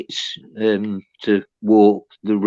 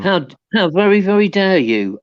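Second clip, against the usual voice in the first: higher, playfully affronted; mock indignation; 8 semitones higher, 3 dB louder, faster than usual. Midlander answering a southern guess, mock indignant. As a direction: put on outraged dignity at the mistake.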